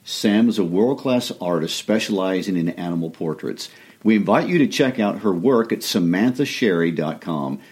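loud, upbeat sponsor read. Acted. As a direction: sell it like a radio ad.

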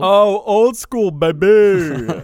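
slow, drawn-out cool-guy drawl. Real, not acted. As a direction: stretch it out like a smooth DJ. Teasing remark on old dates.

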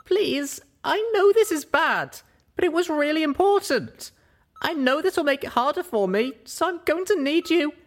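high, loud plea; high, pleading young mare; Pip pleading. Acted. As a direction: beg her to understand.

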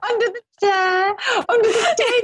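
can barely get it out for laughing; giggling it out. Real, not acted. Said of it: "Bloody hell".

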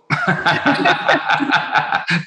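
high giggling laugh; high burst of laughter. Real, not acted. Laughs after swearing.

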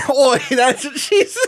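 laughing mock Cockney. Cracking up while keeping the British bit going. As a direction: giggle through a mock Cockney line. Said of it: "my fake British accent".

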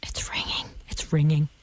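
hushed nervous whisper as the phone rings. Real, not acted. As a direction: breathe the words, barely voiced.

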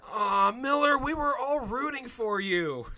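high, drawn-up mock lament; mock disappointment. Listener let down by the miller, mock dismay.

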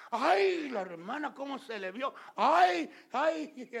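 very high, loud shrieking ays; hammed-up scandalized squeal; mock scandalized gossip. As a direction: shriek like a shocked church gossip. Mocking shocked gossip.

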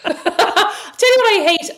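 high, loud words through laughter; laughing. Real, not acted. Podcast host announcing a pet hate, laughing. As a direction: push the words out slowly through a real laugh. Bursts out laughing while talking.

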